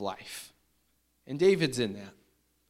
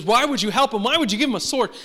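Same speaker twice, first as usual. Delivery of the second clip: Saul's angry accusing shout, raised high and loud; angry king; 9 semitones higher, 7 dB louder, faster than usual. Retold or imitated. Saul yelling at the priest, angry and accusing. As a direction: demand it like a furious king. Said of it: "he's really mad at the priest".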